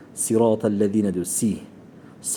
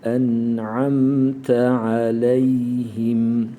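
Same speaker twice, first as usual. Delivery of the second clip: slow chanted En'amte aleyhim; slow recitation; 5 dB louder, much slower than usual. Shown as a demonstration. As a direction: recite it long and melodic. Recitation demo.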